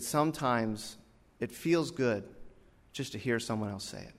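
low, soft, halting confession; imitated hushed, tender admission; tearful sincerity. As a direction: quietly admit it, moved. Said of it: "with tears in his eyes".

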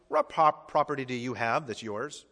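ordinary talk

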